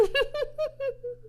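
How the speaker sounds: high hearty spider laugh; Charlotte laughing. Acted. Charlotte laughing at Wilbur's fall, hearty laugh. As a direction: laugh so hard the web sways. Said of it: "Charlotte laughed so hard".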